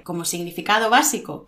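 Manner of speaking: normal talking voice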